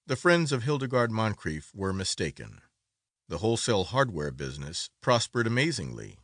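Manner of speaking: plain reading voice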